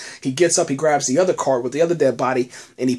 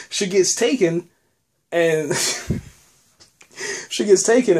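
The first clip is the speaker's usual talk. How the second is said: chuckling through the words, higher; laughing; 4 semitones higher than usual. Real, not acted. Telling viewers Beth got taken, laughing. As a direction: laugh at the twist as you say it.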